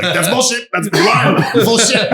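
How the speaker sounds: high, laughing cry of disbelief; laughing mock outrage. Retelling the comic's verdict on the accent, laughing mock outrage. Acts out friend scoffing at accent.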